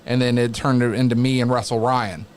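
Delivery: ordinary talk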